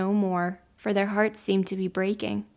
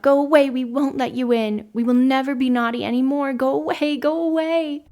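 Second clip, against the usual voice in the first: loud, high pleading shouts; frightened shouting; 4 semitones higher, 5 dB louder than usual. A narrator's reading. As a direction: beg the thing outside to leave. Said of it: "they called out".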